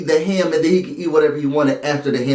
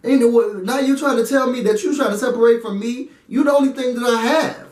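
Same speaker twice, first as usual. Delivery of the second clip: higher, pleading voice, holding back a laugh; Bailey's side, fed up; 8 semitones higher than usual. Retold or imitated. Bailey pushing back on Swaggy, high, indignant. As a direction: play Bailey refusing to be dropped. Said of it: "I got from Bailey's side".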